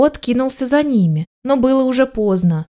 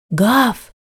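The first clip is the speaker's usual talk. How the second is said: plain reading voice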